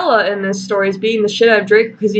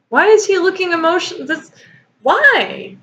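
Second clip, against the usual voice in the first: baffled; 8 semitones higher than usual. Real, not acted. Baffled at the text.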